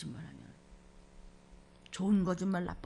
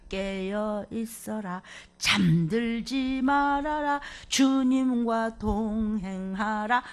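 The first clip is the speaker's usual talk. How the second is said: very slow, drawn-out scripture charge; solemn slow commands; much slower than usual. Acted. Preacher charging the congregation, slow solemn commands. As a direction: intone each command slowly like a decree.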